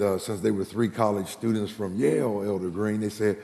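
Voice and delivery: ordinary talk, nothing marked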